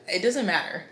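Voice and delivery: ordinary delivery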